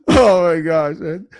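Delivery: loud laugh spilling into words; high burst of laughter, tailing into disbelief; cracking up. Real, not acted. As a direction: laugh hard, then exclaim through it. Cracking up at pirate remark.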